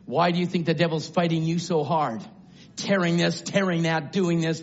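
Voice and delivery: ordinary talk, nothing marked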